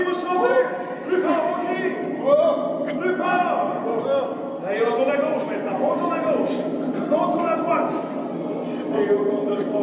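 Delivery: high, loud, building excitement; rising thrill commentary. Acted. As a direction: whip them up on the big drop. Rollercoaster thrill commands.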